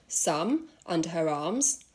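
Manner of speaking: plain reading voice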